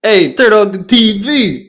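hammed-up Southern accent. Playing a Southern guy yelling for the T V, hammy. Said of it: "my Southern accent".

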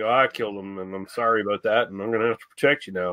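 low, soft, rushed shrug of a confession; mock casual killer. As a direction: toss off the murder as no big deal. Killer acted in retold scene.

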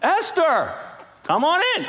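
high, loud eager welcome; welcoming king. Acted. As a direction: call her in like a doting king. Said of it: "you know our king".

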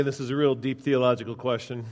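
dry sarcasm, played as grave; sarcastic. Real, not acted. Pastor teasing the congregation with a deep question, sarcastic. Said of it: "sense the sarcasm in my voice".

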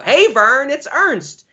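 high-pitched goofy catchphrase call; Ernest. Acted. Co-host quoting the TV show's title, high catchphrase impression. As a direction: sing out the catchphrase, pitched well up. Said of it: "he would pronounce it Ernst".

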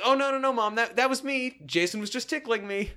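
high, hurried-sounding excuse to Mom; teen covering up. Acted. Son explaining the giggling to Mom, high-pitched cover-up. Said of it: "And he says".

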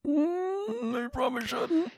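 high, soft, hesitant squeak; reluctant squirm. Retold or imitated. Answering a mother asking about the podcast, high reluctant squirm. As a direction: squeeze it out high and awkwardly.

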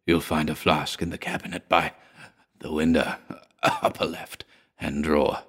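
soft, low, spent murmur; weak mutter. A narrator's reading. Exhausted man mutters directions to his friend, soft and low. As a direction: barely get the words out. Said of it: "he muttered".

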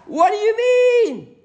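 high, loud, drawn-out cry; hammed-up indignant yell; mock shout. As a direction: belt it out like an outraged scholar. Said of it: "shouted out".

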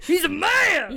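loud, very high laughing squeal; mocking the woman. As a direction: squeal it while imitating her laughter.

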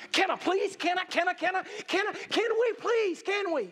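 put-on high, insistent childlike begging; begging demon. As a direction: beg and beg for permission in a high voice. Acting demons begging for permission.

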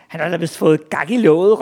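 loud mocking shout; called out. A narrator's reading. Other big boys mock Ivan, loud jeering call. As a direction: jeer it out over the crowd. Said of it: "råbte nogle andre".

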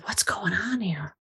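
a hushed question under the breath; whisper. Retold or imitated. Telling the host how she reacted to the sobbing dog and owner, whispered. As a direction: whisper it as if afraid to break the moment.

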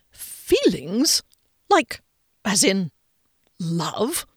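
halting, puzzled question in fragments; baffled. A narrator's reading. Man hearing of his employee's feelings, baffled. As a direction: feel your way to the word, baffled. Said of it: "Rickenbacker was puzzled, baffled even".

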